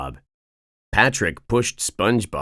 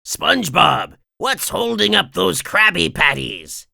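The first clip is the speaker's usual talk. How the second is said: raised boss voice calling to the kitchen; yelling; 11 semitones higher, 4 dB louder than usual. Acted. Mister Krabs yelling at SpongeBob for the patties, raised voice. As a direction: call out impatiently for the patties. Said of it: "yelled Mister Krabs".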